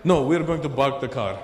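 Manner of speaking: mock Lebanese accent with B for P. Lebanese friend explaining the parking, Lebanese accent. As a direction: say park as bark, lightly Lebanese.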